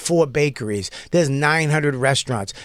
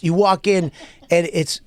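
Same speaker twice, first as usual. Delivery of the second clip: normal talking voice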